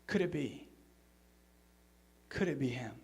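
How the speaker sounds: soft, low, hushed wonder; a hushed, hopeful question; awed hush. Retold or imitated. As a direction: barely dare to ask it. Disciples wondering in awe.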